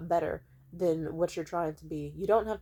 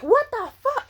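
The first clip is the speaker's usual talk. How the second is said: much higher, loud stunned cry; shocked outburst; 9 dB louder than usual. Retold or imitated. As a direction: yelp it out in disbelief. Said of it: "immediately hearing that".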